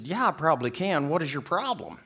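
ordinary talk, nothing marked

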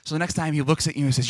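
ordinary talk

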